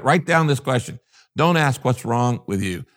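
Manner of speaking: usual speaking voice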